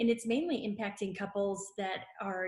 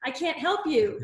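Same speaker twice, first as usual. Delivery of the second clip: exasperated refusal; 6 semitones higher, 8 dB louder than usual. Retold or imitated. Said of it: "And I'm like".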